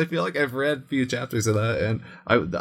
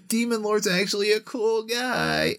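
mocking trope voice; 9 semitones higher than usual. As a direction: parody the trope in a high mocking voice. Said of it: "this archetype where it's like".

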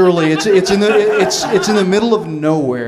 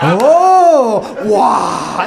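mock amazement; 6 semitones higher, 4 dB louder than usual. Kathy seeing a doorknob, hammed-up amazement. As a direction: gasp in wonder as if it were brand new. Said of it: "she acted like she'd never seen a doorknob before".

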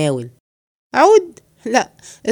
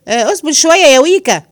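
higher, louder cry of fright; alarmed cry; 6 semitones higher, 7 dB louder than usual. Acted. Man cries out in fright when the sack moves.

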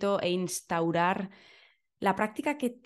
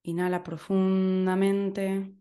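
guided meditation. Acted. Leads a breathing meditation.

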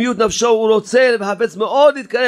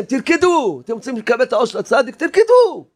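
retold shouting; 2 semitones higher than usual. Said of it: "צעקתי לכם".

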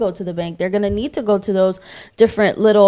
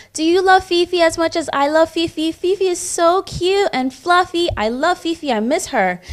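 put-on high, eager child chatter; a small girl gushing about her dog; little girl voice; 8 semitones higher than usual. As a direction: play the excited four-year-old, pitched up. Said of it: "She's like".

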